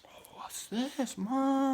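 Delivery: high, slow mock whisper; nosy friend. Friend in the kitchen eyeing the watch, high slow mock whisper. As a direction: coo it like a sly kid eyeing a prize.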